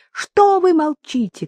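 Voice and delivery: high and loud, frantic reproach; desperate shout. Acted. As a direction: hurl it at him in anguish. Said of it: "кричала она".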